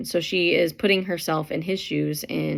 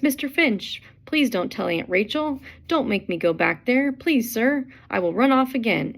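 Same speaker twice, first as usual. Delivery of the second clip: lightly voiced high, pleading plea; pleading boy; 6 semitones higher than usual. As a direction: beg Mr. Finch as a scared runaway boy. Dill begs not to be sent back.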